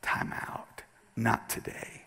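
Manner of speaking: hushed whisper, no voice. Acted. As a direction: breathe it like a secret. Preacher voices Nehemiah in whisper.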